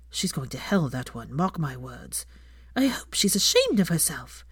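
lightly voiced scandalized church gossips; a hint of shocked old busybodies tutting; mildly voiced disapproving gossip; gossiping townsfolk. A narrator's reading. Gossiping strangers in the cafe.

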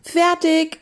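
high, triumphant cry; sustained high call; shout. Acted. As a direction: shout it as if for a whole crew. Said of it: "schrien sie im Chor".

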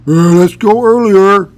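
teasing buddy impression; mock Kevin voice. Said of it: "the second guy was Kevin".

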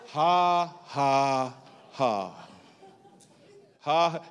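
slow, spaced spoken "ha"s; deliberate forced laugh. Retold or imitated. Preacher retelling Brother Hagin's laugh in sickness, dry forced ha-ha. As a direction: imitate a laugh he didn't feel. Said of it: "I started laughing".

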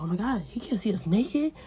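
rising loud panic to a high no; high frantic cry; panicked scramble. Acted. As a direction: scramble in fright. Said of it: "they scramble, like".

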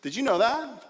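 ordinary talk

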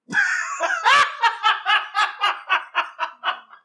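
long, very high peals of laughter; loud laughing fit. Real, not acted. Co-host cracks up at the raw dog joke, loud laughing fit.

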